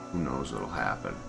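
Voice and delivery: ordinary delivery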